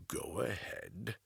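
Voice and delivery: soft, slow, resigned command; quiet grim order. Acted. Interrogator quietly orders torture.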